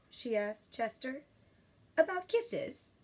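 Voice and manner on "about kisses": put-on child voice. Child asks.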